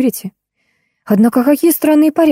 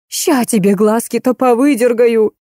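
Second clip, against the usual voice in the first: raging; 3 semitones higher than usual. A narrator's reading. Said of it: "бесновалась Леночка".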